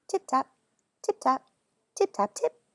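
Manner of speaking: playful tapping rhythm; tapping sounds. Acted. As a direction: mimic a chorus of mice tapping. Chorus of little tappings.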